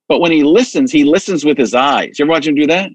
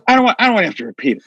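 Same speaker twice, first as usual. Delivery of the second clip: fast higher Biden mumble; Biden impression; 6 semitones higher, much faster than usual. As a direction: rush it like an impatient old man. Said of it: "he'll do the thing".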